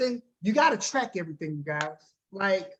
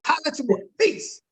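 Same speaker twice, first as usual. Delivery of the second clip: lively voicemail sign-off, pitched-up final word; energetic sign-off; 5 semitones higher than usual. Shown as a demonstration. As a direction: sign off with upbeat swagger. Replays his upbeat sign-off.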